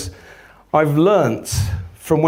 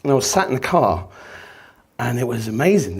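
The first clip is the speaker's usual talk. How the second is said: normal talking voice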